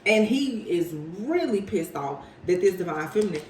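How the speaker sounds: normal talking voice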